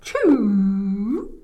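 loud, long build-up into "choo"; hammed-up horse sneeze; big sneeze. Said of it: "But the horse sneezed".

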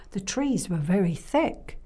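plain reading voice

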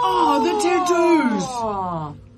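very slow, high thrilled oh; excited. Real, not acted. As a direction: savour the discovery. Shared excited realisation.

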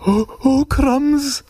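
high, startled little cry; alarmed snake. Acted. Snake takes one look at the Gruffalo, high alarmed voice. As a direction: snake squeaking in dismay.

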